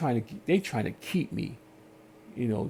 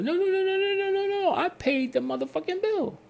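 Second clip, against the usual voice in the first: much higher, indignant string of no's; outraged protest. Retold or imitated. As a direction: squeal the no's up high in disbelief. Retold quarrel with cable company.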